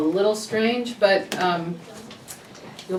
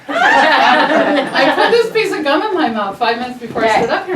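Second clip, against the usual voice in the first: laughing; 7 semitones higher, 11 dB louder than usual. Real, not acted. Laughing at herself over gum.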